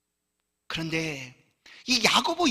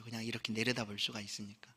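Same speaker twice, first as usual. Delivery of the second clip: whispered aside; 7 semitones lower, 12 dB quieter than usual. Real, not acted. Aside about the upper balcony.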